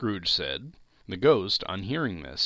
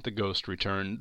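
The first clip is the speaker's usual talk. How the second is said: usual speaking voice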